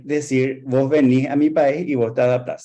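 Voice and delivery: ordinary talk, nothing marked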